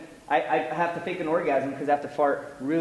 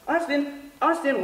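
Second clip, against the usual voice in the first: high-pitched dog call; coaxing the dog; 10 semitones higher, slower than usual. Acted. Calling the dog again.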